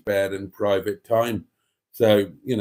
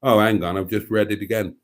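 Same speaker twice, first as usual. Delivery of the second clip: ordinary talk, nothing marked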